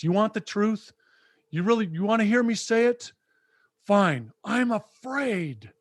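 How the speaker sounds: imitating Rocky finally breaking; emotional confession. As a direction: blurt it out, then give in. Rocky finally confesses his fear.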